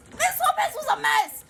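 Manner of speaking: high, loud laughing outburst; laughing shout. Real, not acted. Recalling the teacher's behavior, a high laughing shout. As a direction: crack up and shout it out.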